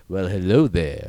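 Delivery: slow, low, smooth ladies'-man purr; Billy D impression. Lando greeting someone with slow, smooth Billy D charm. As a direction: drawl it out like a suave charmer. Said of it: "Doing his best Billy D".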